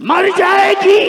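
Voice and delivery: high, loud exclaimed punchline; sudden shout. Acted. Preacher springing the twist on listeners, sudden high shout. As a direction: burst out with it.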